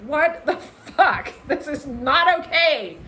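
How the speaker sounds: slow, laughing disbelief in a changed voice; rattled outburst. Retold or imitated. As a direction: spit it out half laughing, half shaken. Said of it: "And I'm like".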